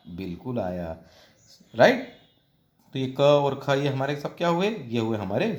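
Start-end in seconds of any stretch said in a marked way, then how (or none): none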